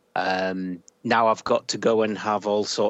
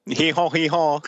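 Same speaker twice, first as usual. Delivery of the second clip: mock French donkey bray; 5 semitones higher than usual. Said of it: "get in on the French vibe".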